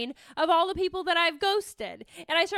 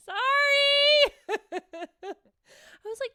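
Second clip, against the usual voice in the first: high, loud squealed sorry through laughter; cracking up mid-apology; laughing squeal; 12 semitones higher, 5 dB louder, much slower than usual. Real, not acted. Laughing at own story.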